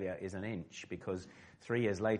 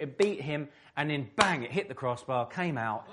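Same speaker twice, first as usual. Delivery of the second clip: rising pitch and louder as the ball flies toward goal; higher and louder, racing to the crossbar; excited build-up; 6 semitones higher, 4 dB louder than usual. Retold or imitated. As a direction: relive the moment, building to the bang. Reliving the goal moment, building.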